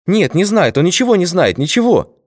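high, hurried scared soldiers; frightened chorus. A narrator's reading. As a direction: plead in panic, all at once. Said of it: "испуганным хором ответили бойцы".

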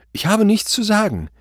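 panicked refusal. A narrator's reading. Frightened suspect refuses to talk. (panische Weigerung)

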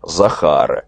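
usual speaking voice

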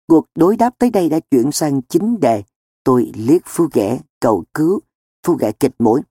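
plain reading voice

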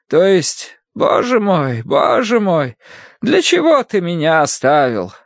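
high scripture declamation; stern Bible-reading woman. Acted. Woman reading Jesus' words to a patient, high declaiming. Mrs. Gadson reads scripture.

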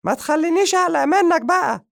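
high-pitched, loud frightened cat voice; frightened cat cry. Acted. The cat backing away from the man, crying out in fright. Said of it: "صاح القط بفزع".

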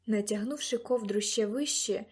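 ordinary delivery

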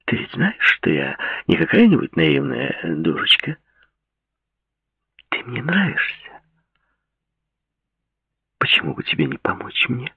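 put-on sweet, insistent young woman; Claudia's voice. A narrator's reading.

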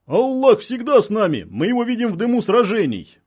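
loud, exalted declaration; fervent proclamation. A narrator's reading. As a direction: declare it proudly across the lines. Militants proclaim faith across lines.

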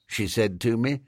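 usual speaking voice

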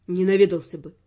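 low, confident man's reply. A narrator's reading. Raymond contradicting the heroine about the Viscount, low and confident. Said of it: "уверенно ответил Раймонд".

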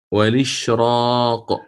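والإشراق drawn out slowly with a heavy emphatic ra. Shown as a demonstration. Teacher demonstrates the heavy ra in والإشراق, slowly. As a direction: stretch والإشراق slowly, ra full-mouthed. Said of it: "اختار الإمام الداني التفخيم فيها".

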